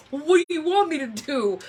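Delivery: exasperated retort. Retold or imitated. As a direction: toss it back like a put-upon husband.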